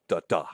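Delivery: DA-DA as a light da, then a stressed DAH; weak first beat, strong second in DA-DA; iambic stress. Shown as a demonstration. Lecturer sounding out the iamb, stress on second beat. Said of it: "followed by a stressed one".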